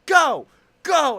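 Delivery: loud, high mock shout; retold impatient yell. Old lady to the stalled car ahead, impatient yelling. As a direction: yell it like an impatient driver behind.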